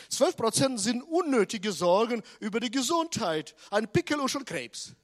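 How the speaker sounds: loud, fast preacher's proclamation; full-force preaching. Acted. Preacher hammering the statistic to the congregation, loud and fast. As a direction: drive the point home at full volume.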